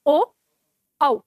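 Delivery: pure ओ, a pause, then औ as an au glide; contrasting o and au. Shown as a demonstration. Teacher shows they differ, ओ pure and औ as au. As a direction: keep ओ round and glide on औ. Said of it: "दोनों का उच्चारण अलग अलग".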